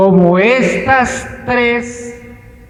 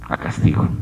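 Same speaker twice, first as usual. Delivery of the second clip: whispered; 5 dB quieter, faster than usual. Acted. Sermon climax on punishment.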